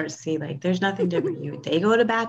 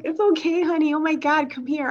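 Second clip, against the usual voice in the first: soothing high voice; 9 semitones higher, 3 dB louder than usual. Retold or imitated. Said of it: "He's all like".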